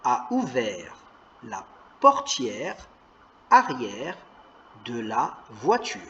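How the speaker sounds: lis mot à mot, lentement; détache chaque mot comme pour un élève; lecture lente. Shown as a demonstration. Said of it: "il y a marqué". (lecture lente, syllabe par syllabe)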